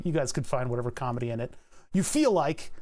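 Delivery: usual speaking voice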